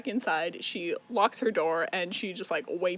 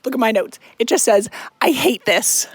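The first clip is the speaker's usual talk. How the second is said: loud exasperation; 3 semitones higher, 13 dB louder than usual. Real, not acted. Reviewer showing her angry notes.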